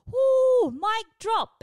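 very loud, high whooping cheer; triumphant whoop. Real, not acted. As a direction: whoop it like you won. Triumphant end of the story.

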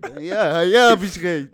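höher und viel lauter, gespielter Jubel; triumphierender Schrei. Pretend. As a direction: schrei deinen Triumph übertrieben raus. Spielt Triumphschrei. (gespielter Triumphschrei, weil er endlich recht bekommt)

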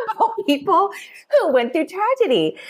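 high, laughing through the words; amused, high-pitched self-mocking laugh. Real, not acted. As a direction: break into a laugh and talk on through it. Laughs at her own past judgement.

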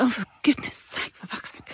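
exasperated under-the-breath muttering; irritated sigh of a sentence; muttered exasperation. Retold or imitated. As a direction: grumble it to yourself, fading out. Irritated mother's inner exasperation.

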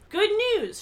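bright news cried out high and loud; happy cry. A narrator's reading. Girl announcing good news to tearful Carla, happy cry. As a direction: cry it out happily. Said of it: "she cried out happily".

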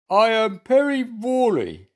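ordinary delivery

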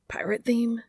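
slightly too high. A narrator's reading. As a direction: let the voice creep up in dismay. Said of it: "his voice pitched only slightly higher than he would have liked".

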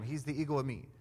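usual speaking voice

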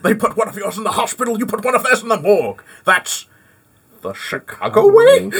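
hammed-up Scottish Connery, ending high and laughing; mock Connery Scots growl of the Untouchables line; Sean Connery impression. Quoting The Untouchables, hammed-up Scottish Connery impression. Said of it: "Go Sean Connery from, uh, Untouchables".

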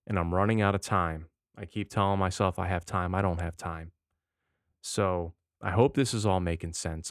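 ordinary talk, nothing marked

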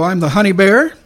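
normal talking voice

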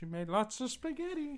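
high, soft cartoon voice; put-on character voice. Co-host answers the joke about the cake in a high, soft put-on voice.

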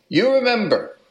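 put-on quick high Italian accent; playful put-on Italian accent; lightly done Italian accent. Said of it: "I'm doing the Italian accent again".

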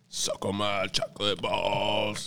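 slow, low, crooning quote; South Park Chef impression. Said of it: "that's a thing from South Park".

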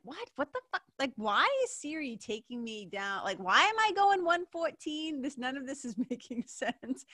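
high-pitched, hammed-up disbelief; baffled outburst. Driver questioning Siri's strange route, baffled and exasperated. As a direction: question it in mock exasperation, laugh creeping in late.

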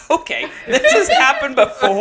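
high, laughing incredulous question; laughing disbelief. Real, not acted. Storyteller recalling her shock, laughing in disbelief. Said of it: "laughing disbelief".

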